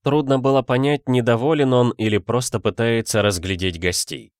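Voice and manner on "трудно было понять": ordinary delivery, nothing marked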